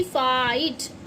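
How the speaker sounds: फाइट stretched slowly, faa-eet; very slow fight. Shown as a demonstration. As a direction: draw फाइट out for the class. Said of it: "silent हुआ".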